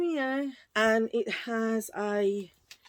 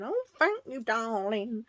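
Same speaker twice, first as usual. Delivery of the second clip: posh lady voice; 3 semitones higher than usual. Retold or imitated. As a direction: play a grand lady gushing. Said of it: "She don't talk like that".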